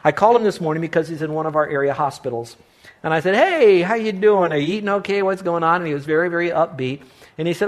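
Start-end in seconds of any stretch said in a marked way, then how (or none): 3.4-5.8 bright, higher, louder hello over the phone; cheery phone greeting. Retold or imitated. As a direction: greet him like a caring pastor on the phone. Pastor phoning sick friend cheerfully.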